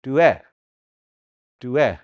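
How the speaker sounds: "Due" starting between a T and a D; soft T-D. Shown as a demonstration. Teacher repeating the right sound, "Due" with T-D start. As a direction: soften the opening of "Due" towards D. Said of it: "So it's a T D".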